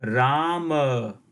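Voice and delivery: "Rama" with long first vowel and sounded final a; full last syllable. Shown as a demonstration. Teacher showing the Sanskrit pronunciation, "Rama" with full final syllable. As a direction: draw out "Rama", releasing m into a short a. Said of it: "will be pronounced as".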